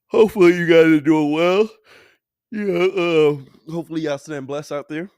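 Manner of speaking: talking through a yawn; words stretched by a yawn. Real, not acted. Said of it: "I'm sorry y'all yawning".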